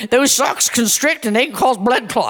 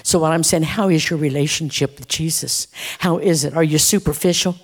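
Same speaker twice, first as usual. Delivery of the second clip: earnest closing appeal; 7 semitones lower than usual. Real, not acted. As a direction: ask them gently but seriously. Low intimate closing appeal to church.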